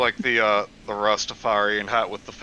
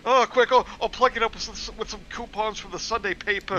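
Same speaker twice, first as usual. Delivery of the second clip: high, frantic comic voice; panicky high voice; 10 semitones higher than usual. Acted. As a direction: fuss frantically like a miser. Friend continues the panicked voice.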